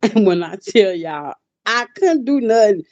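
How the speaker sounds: laughing through the words; half laughing, can't hold it in; giggling while telling. Real, not acted.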